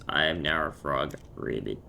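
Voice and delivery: low croaky frog voice, dropping deep on Ribbit. Pretend. Player announcing they are now a frog, low croak. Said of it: "croaky voice".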